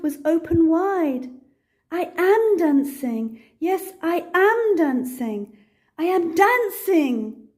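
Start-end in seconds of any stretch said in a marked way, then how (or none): 1.9-7.5 higher, overjoyed exclaiming, stress on am; joyful cry. Acted. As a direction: Gerald can't believe he's dancing. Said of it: "Gerald cried".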